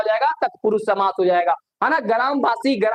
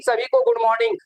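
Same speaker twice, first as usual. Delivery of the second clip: much higher sing-song good morning; bright high greeting; 11 semitones higher than usual. Real, not acted. Teacher greeting students in the online class, high and cheerful.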